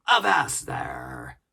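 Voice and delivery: loud, slow shout to stop them; commanding cry. A narrator's reading. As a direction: bark the order. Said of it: "cried Silver".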